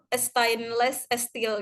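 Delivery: slow imitated Iranian English, "e-stainless e-steel"; Iranian accent. Student imitating the lecturer's thick Iranian accent, slowly. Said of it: "logat Irannya tuh kental banget".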